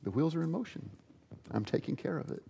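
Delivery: quiet assurance, hushed; hushed divine promise; calm God. Acted. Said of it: "his response to me is going to be".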